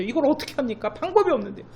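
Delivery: 이걸 어떻게 합니까 pleaded high; pastor's helpless inner cry, high; helpless protest. Retold or imitated. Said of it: "제 마음의 한쪽은요".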